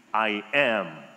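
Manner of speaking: "I am" low, firm and deliberate; weighty proclamation. Acted. Jesus answering those arresting him, "I am" as a weighty proclamation. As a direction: proclaim "I am" simply, pitch dropped low. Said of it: "explosive proclamation saying simply".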